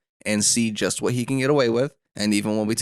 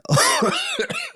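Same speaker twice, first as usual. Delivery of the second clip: laugh. Real, not acted. Laughs at own coughing fit.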